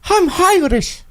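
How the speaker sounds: high breathy "I'm Irish" with an H; Irish accent. Shown as a demonstration. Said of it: "It's not bad".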